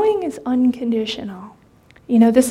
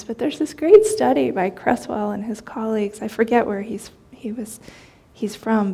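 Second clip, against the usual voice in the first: ordinary delivery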